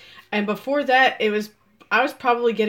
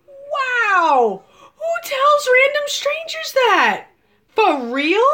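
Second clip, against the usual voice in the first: very high, drawn-out mock shriek; incredulous shock; 13 semitones higher, much slower than usual. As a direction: wail it in exaggerated disbelief. Acts out shock at stranger's confession.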